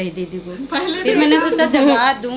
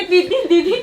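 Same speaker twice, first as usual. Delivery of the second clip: lightly imitating a worried call, higher, louder; mock urgent call; 7 semitones higher, 5 dB louder than usual. Helper imitating herself waking the sister, laughing and higher. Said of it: "तो क्या".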